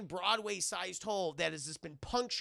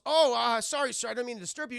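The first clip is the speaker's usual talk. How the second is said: startled, higher, loud-starting apology; flustered apology; 5 semitones higher, 8 dB louder, faster than usual. Acted. Startled gargoyle apologises to the blind man, flustered. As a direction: stumble into a hasty, raised-voice apology.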